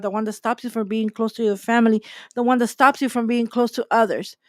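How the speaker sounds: ordinary talk, nothing marked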